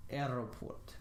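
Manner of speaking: "aeroport" with a lightly rolled Russian R. Shown as a demonstration. Teacher showing the Russian R in airport, lightly rolled. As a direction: give the R in "aeroport" a little roll. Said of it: "a little bit of a roll to it".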